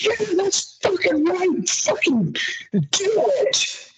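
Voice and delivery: high, drawn-out hype shout for a friend; hype cheer. Retold or imitated. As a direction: cheer your buddy through the squat. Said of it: "You're like".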